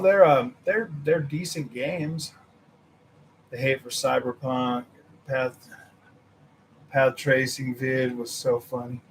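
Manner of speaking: normal talking voice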